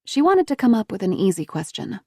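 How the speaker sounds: plain reading voice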